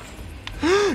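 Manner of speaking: sharp hammed-up gasp of fright; mock gasp. Gamer fakes fright at the monster behind him, sharp gasp. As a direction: gasp as if the monster just appeared.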